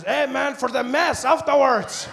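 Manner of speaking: high, slow booming shout; taco truck vendor. Retold or imitated. Taco seller explaining to the customer, loud drawn-out holler. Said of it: "He says".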